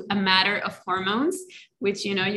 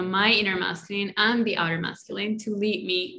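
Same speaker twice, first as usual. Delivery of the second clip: ordinary talk, nothing marked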